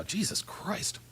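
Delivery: breathy exasperated whisper to himself. Real, not acted. Streamer hunting for a file, whispering in frustration. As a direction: hiss it under your breath. Muttering to self while searching.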